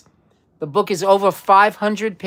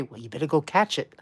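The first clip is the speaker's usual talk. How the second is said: softer, lower, quick deadpan punchline; 5 semitones lower, 8 dB quieter, faster than usual. Retold or imitated. Quiz host telling the old joke, quiet deadpan punchline. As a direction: drop it low like a sly joke ending. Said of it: "then the person would say".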